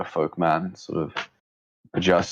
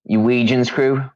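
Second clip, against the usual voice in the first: raised, louder halfling voice; small high voice; 5 semitones higher, 4 dB louder than usual. Acted. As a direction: redo it in the little halfling voice. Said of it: "Uh, sorry, my bad".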